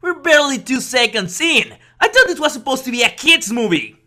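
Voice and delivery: loud, scandalized disbelief; shocked outburst. Real, not acted. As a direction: yell in outraged shock.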